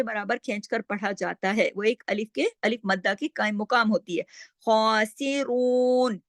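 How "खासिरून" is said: slow, drawn-out Quran recitation; chanting the verse as a teacher's model; tajweed recitation. Shown as a demonstration. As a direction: recite it slowly with careful tajweed. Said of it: "रा को दो हरकत".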